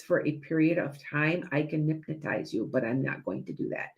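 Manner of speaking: low, soft, drawn-in hypnotic murmur; soft hypnotist voice. Shown as a demonstration. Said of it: "if I were to talk like this".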